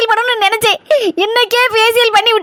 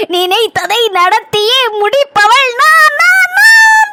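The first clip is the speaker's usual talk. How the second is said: hammed-up villain boast; 3 semitones higher, 3 dB louder, much slower than usual. Boasting to Chinna about kidnapping the girl, hammy film-villain style. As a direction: deliver it like a movie villain's punch line.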